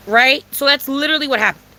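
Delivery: ordinary delivery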